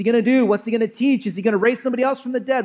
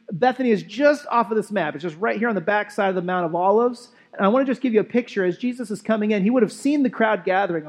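usual speaking voice

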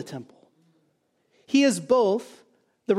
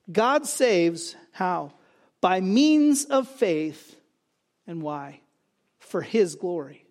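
very slow, each word weighed, "means" stressed; slow emphatic point; 3 semitones lower, much slower than usual. Real, not acted. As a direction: drag it out so the congregation hangs on "faith". Preacher hammers home key point.

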